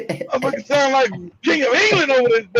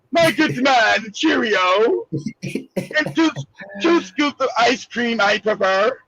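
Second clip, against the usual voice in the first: put-on slow, lightly posh English accent; hammed-up upper crust English gentleman; slower than usual. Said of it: "No, he got the".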